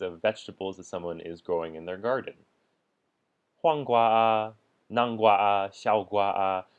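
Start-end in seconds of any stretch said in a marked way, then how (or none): 3.0-6.7 黄瓜啊, 南瓜啊, 小瓜啊 each with a held 啊; emphasized list items. Shown as a demonstration. Teacher listing garden vegetables, each of 黄瓜啊, 南瓜啊, 小瓜啊 emphasized. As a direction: stress every item, 黄瓜啊, 南瓜啊, 小瓜啊. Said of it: "just emphasizing each item in a list for clarity".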